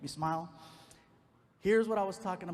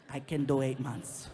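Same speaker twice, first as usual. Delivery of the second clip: mom's voice; 3 semitones lower, slower than usual. Retold or imitated. Mom's voice, retold.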